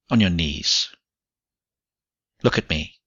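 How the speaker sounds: loud, firm orders; commanding. A narrator's reading. Man orders the woman before him, commanding. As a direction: give each order as a master expecting obedience. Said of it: "His voice commands you".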